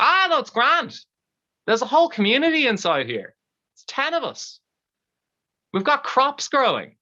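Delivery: very high-pitched, chirpy trapped man; cheery reassurance. Acted. Trapped man tells husband it's grand, high chirpy reassurance. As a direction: brush off the worry in a high voice.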